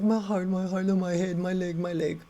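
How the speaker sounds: high, whimpering imitation; mock crying. Admired friend moaning about herself, mock crying. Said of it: "crying and saying".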